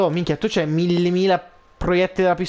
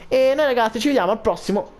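higher, upbeat sign-off; youtuber outro; 6 semitones higher than usual. Acted. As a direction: say goodbye to the viewers.

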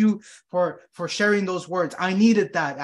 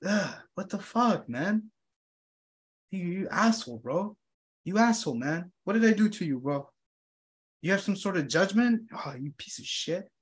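hurt grumbling; 5 dB quieter than usual. Acted. Acting out hurt inner reaction.

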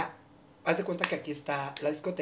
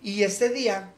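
ordinary talk, nothing marked

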